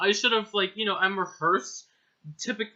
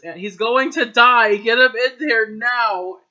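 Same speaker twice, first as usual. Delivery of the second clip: much louder, slower furious order; angry demand; 5 semitones higher, 13 dB louder than usual. Acted. Friend yelling at the nurse. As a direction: bark the order at staff.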